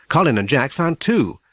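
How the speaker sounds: ordinary delivery, nothing marked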